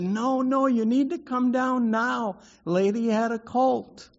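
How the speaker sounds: put-on high, pressing child's shout; insistent yell. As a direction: keep yelling until dad comes down. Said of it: "So I keep yelling this".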